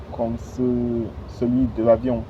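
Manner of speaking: ordinary delivery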